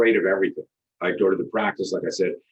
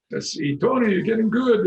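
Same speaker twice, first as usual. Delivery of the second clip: a much higher, lightly mocking friend's voice; imitating Joey; 13 semitones higher than usual. Telling a guest how a friend praised his kicks, in a higher, lightly mocking voice. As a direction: pitch it up to play the admiring buddy. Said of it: "this guy, Joey, is standing there going".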